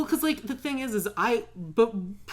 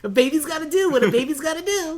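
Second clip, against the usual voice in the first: higher, put-on cartoon toddler; cartoon baby voice; 9 semitones higher, 4 dB louder than usual. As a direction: play a bossy cartoon baby. Cartoon baby impression reply.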